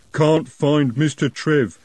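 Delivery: slow plodding troll. A narrator's reading. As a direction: drag it out, dim troll. Troll speaks.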